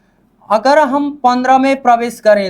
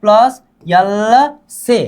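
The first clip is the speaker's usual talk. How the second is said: slow teaching demo; much slower than usual. Shown as a demonstration. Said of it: "तेरह बनाने के लिए भी यल चाहिए पहले".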